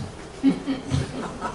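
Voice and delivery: light wordless laugh; chuckle. Real, not acted. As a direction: chuckle at your own joke. Laughs at own joke haiku.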